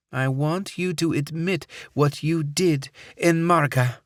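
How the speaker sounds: put-on clipped and cold, Middle Eastern accent; terse Middle Eastern accent. A narrator's reading. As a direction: bite off each word, Middle Eastern accent. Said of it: "she said tersely".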